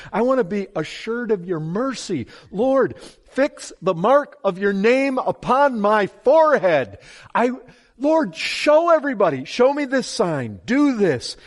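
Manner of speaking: a preacher demanding a sign from God; fervent pleading. Acted.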